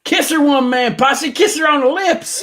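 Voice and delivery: high, loud mock heckle from the crowd; teasing heckler egging on a wrestler; mock fan yell. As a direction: shout it up high like a rowdy fan.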